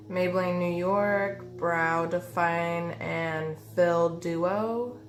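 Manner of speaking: ordinary delivery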